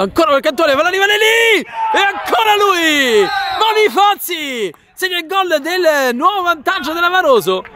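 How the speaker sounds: high, stretched shouting goal call; breathless italian goal commentary. Real, not acted. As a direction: yell the scramble into the goal.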